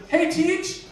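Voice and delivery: lightly voiced high kid asking teacher; eager schoolkid. Kid approaching teacher in story.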